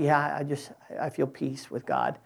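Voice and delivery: soft, subdued imitation of placid piety; hushed, low-key mock contentment; mock quiet peace. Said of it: "It's not this quiet".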